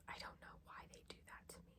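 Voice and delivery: a quick breathy complaint under her breath; whispered aside. Real, not acted. Whispering a grumble to the camera about gunky blush. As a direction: whisper it fast as a private grumble.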